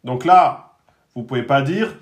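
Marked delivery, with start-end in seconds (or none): none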